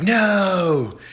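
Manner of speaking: high, drawn-out mock "no"; alarmed warning. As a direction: imitate a desperate cry to stop. Warning cry acted in retelling.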